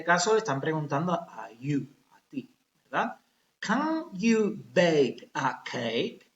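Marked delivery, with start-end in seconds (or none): 3.6-6.3 slow model question. Shown as a demonstration. Teacher models question slowly.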